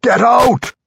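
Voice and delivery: furious high dwarf shout; roaring. A narrator's reading. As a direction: roar at the intruder to leave. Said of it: "Gwent roared".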